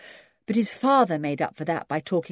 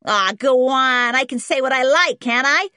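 put-on cheeky American boy; 8 semitones higher, 8 dB louder than usual. A narrator's reading. Boy answering the twins back, cheeky and loud.

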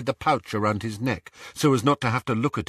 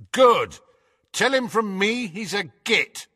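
annoyed; 10 semitones higher than usual. Acted. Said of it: "Ron's annoyed voice echoed distantly".

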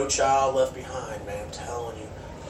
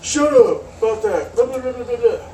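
mocking bratty teen; 10 semitones higher, 4 dB louder than usual. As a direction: mimic a sulky teen brushing it off. Mocking the daughter's snappy reply.

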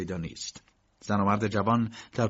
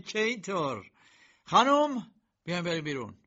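higher, scornful, calling out خانم; mocking; 8 semitones higher than usual. A narrator's reading. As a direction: sneer and beckon the lady. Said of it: "کی با تمسخر گفت".

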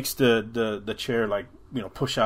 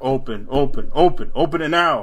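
building from firm to a loud, higher demand; getting louder and mad; 4 semitones higher, 9 dB louder than usual. Retold or imitated. Mother's voice turning on Daniel, louder and angry. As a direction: push harder each time until it's yelling. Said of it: "it starts getting louder".

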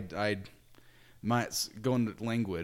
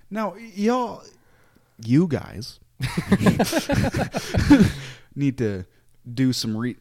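higher Southern drawl broken by laughter; joking Southern accent; 5 semitones higher, 8 dB louder, slower than usual. Retold or imitated. Missionaries preaching to people in a joking Southern accent. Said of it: "jokingly talk in a Southern accent".

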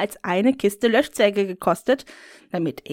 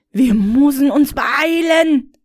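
loud, raised, slow urging; urgent shout; 5 semitones higher, 4 dB louder than usual. Acted. Woman urging the whip-wielder, loud urgent call. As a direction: hurry them along loudly.

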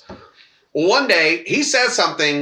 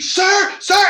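mock teacher calling. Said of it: "And the teacher".

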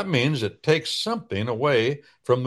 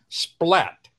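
a bright sound-effect "splat"; imitated splat; 7 semitones higher than usual. Shown as a demonstration. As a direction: pop "splat" out as a sound effect, high and sharp. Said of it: "In English, we say splat".

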